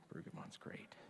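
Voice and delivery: soft whispered "Brueggemann's great."; confiding whisper to the congregation; whispered aside. Real, not acted. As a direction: whisper it like a secret. Aside about the author.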